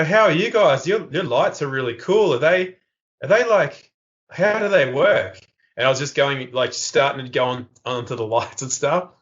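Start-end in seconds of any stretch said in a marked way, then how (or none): 0.0-5.4 higher-pitched innocent wonder; mock-naive curiosity; playing naive. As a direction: act childishly fascinated, pitch lifted. Playing naive to distract the police.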